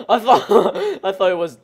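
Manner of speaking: laughing through the words at his own innuendo. Real, not acted.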